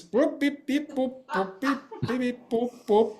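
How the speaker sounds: high beep-boop robot chirps. Retold or imitated. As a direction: chirp like a little droid. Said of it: "it will come like this".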